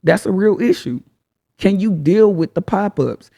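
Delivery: usual speaking voice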